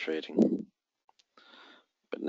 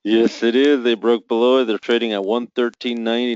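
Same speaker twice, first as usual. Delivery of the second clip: higher, louder triumphant burst; excited; 5 semitones higher, 6 dB louder than usual. Real, not acted. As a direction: cheer as the level finally breaks. Price finally breaks below level.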